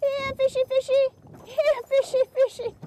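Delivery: mock Norm calling. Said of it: "Can't you see Norm out there going".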